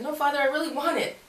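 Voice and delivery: put-on high, childlike begging; whiny pleading. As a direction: plead like a kid who wants the treat. Pleading with God over cookies.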